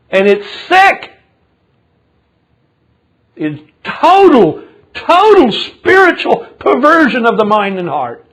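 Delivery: loud, high indignant sermon; full-force preaching. Real, not acted. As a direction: preach it out with outrage, pitch soaring on key words.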